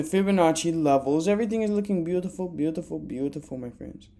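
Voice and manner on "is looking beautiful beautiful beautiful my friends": fading repeat. Acted. Repeated word fading away.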